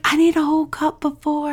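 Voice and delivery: high, teasing imitation of a husband; insistent husband. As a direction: imitate him pleading his case in a high voice. Imitating husband's reply about coffee.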